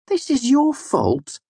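slow, high-pitched blame; flatly. A narrator's reading. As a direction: lay the blame slowly and deliberately. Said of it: "Bast said flatly".

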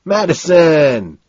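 slow, high, loud call; drawn-out holler; crying back. A narrator's reading. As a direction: shout the name back, stretched out. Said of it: "Price cries back".

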